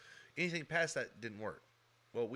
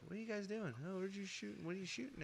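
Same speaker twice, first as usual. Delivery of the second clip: high, hushed mom voice; a mother asking what's going on, voiced higher; mom demanding; 7 semitones higher, 11 dB quieter, faster than usual. Mom catching boys shooting guns.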